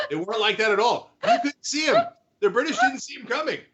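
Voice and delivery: high, hammed-up boastful mimicry; mocking Trump voice. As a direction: puff it up like a bragging president.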